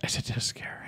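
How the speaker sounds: hushed, unvoiced question; mock conspiratorial whisper. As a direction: whisper it like a secret. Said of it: "He goes".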